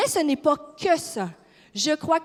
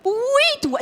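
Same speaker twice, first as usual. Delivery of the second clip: much higher and louder insistence; excited "yes, you" to the room; emphatic answer; 11 semitones higher, 5 dB louder than usual. Acted. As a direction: point right at her, eager. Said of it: "answers the imagined doubt".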